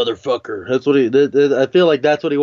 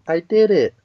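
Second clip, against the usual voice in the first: a little higher, a hint of camp; lightly mock a prissy little brag; mildly camp little boast; 6 semitones higher than usual.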